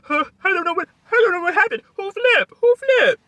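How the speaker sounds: very high, loud, fast mock panic; mock dramatic panic. Mocking Marty pretending not to know who flipped, very high and rushed. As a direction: rush it like a panicked drama queen. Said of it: "cry baby ass fest".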